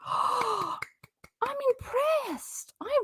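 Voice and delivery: gasp, very high and slow mock wonder; sarcastic awe. Sarcastically praising Katie's forehead title, high mock awe. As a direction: fake being amazed.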